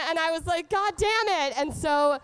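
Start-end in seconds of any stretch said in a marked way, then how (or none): 0.7-1.6 higher, louder exasperated exclaim; exasperated outburst. Retold or imitated. Alone in her room after the trip, a high exasperated outburst. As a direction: let the pent-up frustration burst out. Telling of pent-up release.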